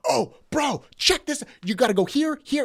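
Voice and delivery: high, loud mock excitement; excited discovery. Retelling a plant worker showing his find, excited discovery. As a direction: imitate a guy bursting to show his buddy.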